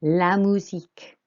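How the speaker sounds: MUSIQUE repeated, U like "oo"; teacher's model of MUSIQUE for repeating; French U sound. Shown as a demonstration. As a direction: clear French MUSIQUE without a "you" glide. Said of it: "the U is an U sound".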